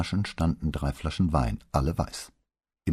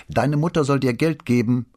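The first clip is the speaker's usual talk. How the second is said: louder, higher parting shot; father calling after his daughter as she leaves; calling after her; 9 semitones higher, 8 dB louder than usual. A narrator's reading. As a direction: shout it down the hall after her. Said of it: "rief er ihr nach".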